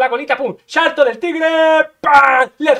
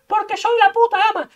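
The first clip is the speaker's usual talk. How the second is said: smug inner voice; 9 semitones higher than usual. Acted. Inner voice gloats back at the gamer. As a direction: gloat like a bratty voice in his head.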